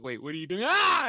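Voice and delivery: casual question breaking into a very high, loud mock scream; imitated dying scream. Podcaster retells how his friend got cut off, mock dying scream. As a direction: start casually, then shriek as if dying. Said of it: "And he's just dying".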